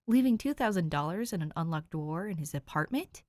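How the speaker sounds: usual speaking voice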